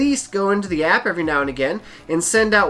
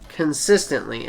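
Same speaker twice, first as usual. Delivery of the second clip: consistently, slow and deliberate; "consistently" stretched out slowly and pressed down low; emphasis on consistently; 5 semitones lower than usual. Shown as a demonstration. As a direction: lean into "consistently" syllable by syllable. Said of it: "Emphasis on the word consistently".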